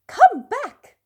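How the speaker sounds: high, loud call to stop him. A narrator's reading. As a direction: shout after the runaway bear. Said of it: "called a girl in charge".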